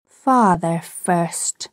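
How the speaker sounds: put-on slow, stubborn small-boy reply; suspicious child. A narrator's reading. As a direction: draw it out, distrustful. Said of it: "who was of a suspicious nature".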